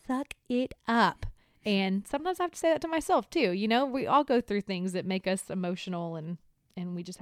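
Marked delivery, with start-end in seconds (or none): 0.1-1.3 slow, drawn-out order, a little higher; tough-love parent. Retold or imitated. Parent to an over-emotional son, tough love. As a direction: stretch out each word as a telling-off. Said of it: "'cause sometimes I'm like".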